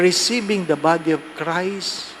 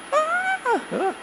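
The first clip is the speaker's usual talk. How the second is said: high, short squealed scream; mock scream; 5 dB quieter than usual. Passengers screaming as the plane dives, high squeal.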